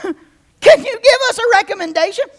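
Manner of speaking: laughing through the question. Real, not acted. Preacher laughing while mocking the disciples' question. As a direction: crack up mid-line.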